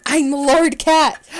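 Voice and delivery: slow, loud, grand announcement; slow proclamation. Acted. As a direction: declare it like royalty. Proclaims new game name.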